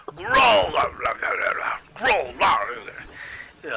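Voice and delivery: loud guttural brute voice garbling muffled words; gruff, deep-throated growl. Acted. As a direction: roar out gibberish like a gruff monster. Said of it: "you hear a gruff, deep-throated voice".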